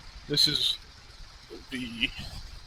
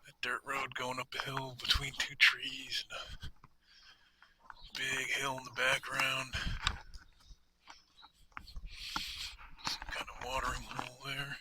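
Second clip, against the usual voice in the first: whispering; 12 dB quieter than usual. Real, not acted. Quiet countryside, keeps voice down.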